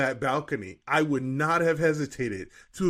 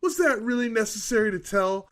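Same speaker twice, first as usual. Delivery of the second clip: mock indignant rant; 7 semitones higher than usual. Reading the angry reviewer aloud to co-hosts, mock indignant. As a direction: play the reviewer's outrage for laughs, building to the end.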